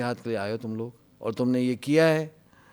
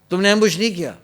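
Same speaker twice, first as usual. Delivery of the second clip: higher, louder disbelieving demand; mock the general's angry challenge; angry question; 6 semitones higher, 6 dB louder than usual. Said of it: "ایسے غصے میں تھا". (غصے میں سوال)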